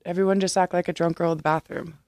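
ordinary talk, nothing marked

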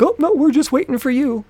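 high-pitched put-on old lady voice. Old lady answers the angry driver, in high imitated voice. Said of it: "says the old lady".

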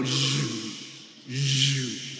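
low buzzing plane sound; droning zzz; imitated plane noise. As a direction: make a low buzz like the plane. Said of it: "the plane goes, zzz".

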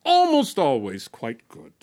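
measured, dramatic poetry performance; spoken word poem. Acted. Performer reciting a ghost's monologue to the audience, dramatic spoken word. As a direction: recite it as the ghost telling his own death.

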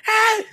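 high, loud imitated shriek; a sudden shrill outburst; mock yell. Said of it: "tú tienes que". (grito imitado, agudo y fuerte)

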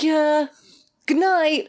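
high, offhand mock sign-off; breezy dismissal. Manager leaving new staff with no explanation, breezily dismissive. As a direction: toss it off and be gone. Manager drops news and leaves.

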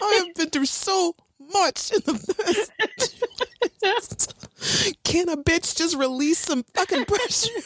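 high-pitched venting; mock fed-up outburst; comic exasperated rant. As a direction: plead your case at the end of your rope, hammed up. Acting Buffy exasperated, venting at Dawn.

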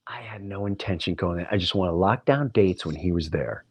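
ordinary talk, nothing marked

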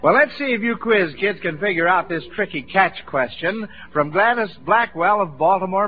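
ordinary delivery

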